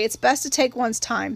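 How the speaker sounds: ordinary delivery, nothing marked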